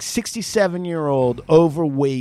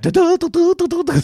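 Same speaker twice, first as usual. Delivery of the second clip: high loud mock duh duh; dumbfounded babble; 13 semitones higher, 5 dB louder than usual. Assistant who can't follow the old man's talk, high mock babble. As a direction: babble like you're lost. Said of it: "She's like".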